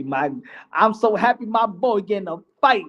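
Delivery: ordinary delivery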